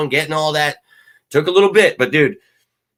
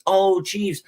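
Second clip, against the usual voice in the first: mocking Chiefs; 7 semitones higher, 6 dB louder than usual.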